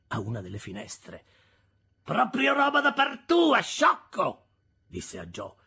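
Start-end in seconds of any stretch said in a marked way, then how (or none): 2.0-4.5 high, loud, drawn-out scolding. A narrator's reading. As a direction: scold a husband at high pitch. Sister scolds Joe from window.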